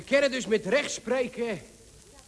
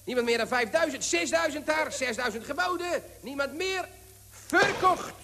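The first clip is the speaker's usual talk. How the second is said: quick rising auction patter, climbing higher; auctioneer; 4 semitones higher than usual. Acted. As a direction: rattle off bids like an auctioneer. Auctioneer calls rising bids.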